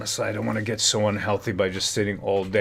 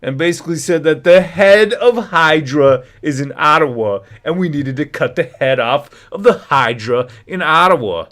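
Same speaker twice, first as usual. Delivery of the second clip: louder, higher passionate retelling; retelling the chief's outburst with a raised voice; 5 semitones higher, 8 dB louder than usual. Retold or imitated. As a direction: push it out like an impassioned chief. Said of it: "very passionate and raised voice".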